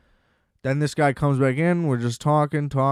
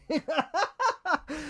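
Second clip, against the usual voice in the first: high laugh; 14 semitones higher, 8 dB quieter than usual. Real, not acted.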